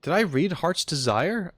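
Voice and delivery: ordinary talk, nothing marked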